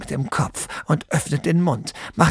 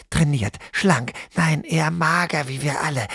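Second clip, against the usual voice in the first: plain reading voice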